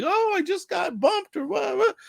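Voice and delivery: hammed-up little player milking a bump; whiny kid voice. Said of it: "of like".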